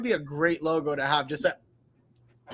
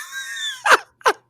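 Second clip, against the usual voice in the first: laughing; 6 dB louder than usual. Real, not acted. Giggling at a meme.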